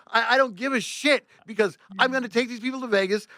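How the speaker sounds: high, insistent rant; defiant. Retold or imitated. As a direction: dig in against the boss. Retold quarrel with boss.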